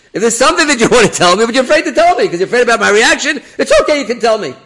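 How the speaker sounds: high, loud, with laughter breaking in; coaxing rabbi repeated. Retold or imitated. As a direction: repeat the plea, laughing as you go. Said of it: "I'll repeat myself".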